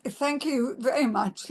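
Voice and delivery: usual speaking voice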